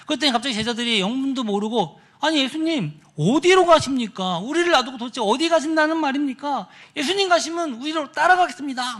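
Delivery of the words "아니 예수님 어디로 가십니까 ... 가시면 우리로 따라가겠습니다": high-pitched, anxious questioning; acted worried plea. Disciples plead with departing Jesus, acted worry. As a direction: plead as if about to be left behind. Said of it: "한편으로는 걱정, 염려로 가득해서".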